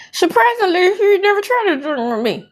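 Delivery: high mock voice. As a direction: pitch up and mimic her prim claim. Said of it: "quoting Trish".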